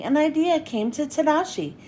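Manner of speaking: plain reading voice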